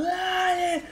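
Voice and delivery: high, drawn-out party yell; rowdy shouting. Retold or imitated. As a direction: holler it long and high over a club crowd. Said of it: "a los gritos, gritando". (gritando a los gritos, agudo y alargado)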